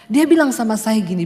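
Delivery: ordinary delivery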